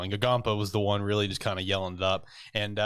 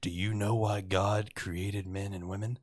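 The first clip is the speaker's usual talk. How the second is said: mock pickup line; 4 dB quieter, slower than usual. Retold bar pickup line.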